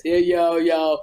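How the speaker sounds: low, put-on cartoon character voice; deep, exaggerated imitation; Kim Whitley. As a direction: mimic a colleague in a deep mock voice. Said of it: "You used to be talking like this".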